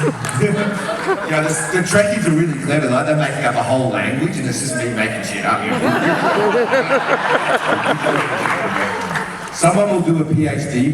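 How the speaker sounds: high, laughing through the words. Real, not acted. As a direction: giggle while you talk. Laughing through a funny story.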